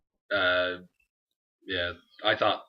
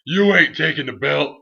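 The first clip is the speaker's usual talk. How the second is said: imitating a trash-talking star, slow and loud; The Rock impression; 2 semitones higher, 7 dB louder, slower than usual. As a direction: drag out every threat like a cocky champion. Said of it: "He's like".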